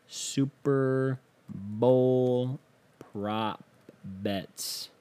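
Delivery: very slow, stretched-out words while busy searching; absent-minded drawl. Real, not acted. Host looking up prop bets aloud, absent-minded drawl. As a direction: drag each word out as you look it up.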